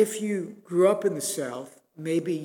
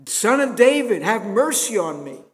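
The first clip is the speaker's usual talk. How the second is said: higher, louder shout for mercy; crying out louder; 6 semitones higher, 8 dB louder than usual. Acted. A blind beggar shouting over the crowd to Jesus, loud desperate cry. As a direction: call over the crowd to be heard. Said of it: "he cries out all the louder".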